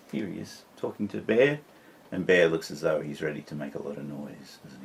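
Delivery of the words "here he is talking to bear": ordinary delivery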